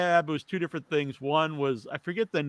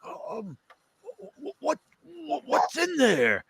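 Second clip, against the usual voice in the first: put-on confused old man; 9 semitones higher, slower than usual. Old roommate Fred voiced.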